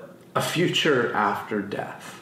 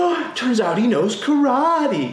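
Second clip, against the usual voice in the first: high, loud, wide-eyed amazement; amazed kid; 11 semitones higher, 4 dB louder than usual. Retold or imitated. Kid amazed as the film reveals Daniel's karate. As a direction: sound like the twist just blew your mind.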